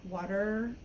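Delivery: "water" with the T as a quick tap, like a Spanish R; "water" with a soft tapped middle; American flapped T. Shown as a demonstration. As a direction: say "water" as if spelt with D's. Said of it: "like, two D's or maybe, like, an R".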